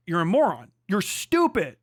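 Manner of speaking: ordinary delivery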